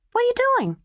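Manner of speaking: put-on high eager child question; curious little girl. A narrator's reading. Mimi asks the babysitter, high and curious. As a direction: lean in wanting to know.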